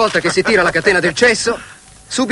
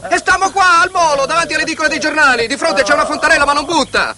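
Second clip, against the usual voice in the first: shouting; 8 semitones higher than usual. Retold or imitated. Alberto yelling on radio.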